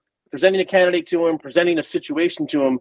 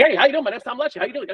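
higher, rushed greeting, words running together; rookie fast talk; 7 semitones higher, faster than usual. Shown as a demonstration. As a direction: gabble it like an overexcited rookie. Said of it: "I talked like".

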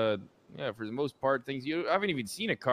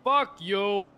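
angry yell; 6 semitones higher than usual. Pretend. Heckler to passing driver, angry yell. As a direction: throw it out at a driver.

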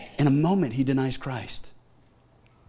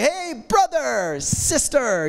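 higher, slow, drawn-out hail; overfriendly greeting; 6 semitones higher, much slower than usual. Acted. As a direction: greet them heartily to hide a forgotten name. Greeting people whose name escapes him.

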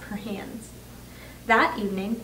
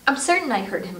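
usual speaking voice

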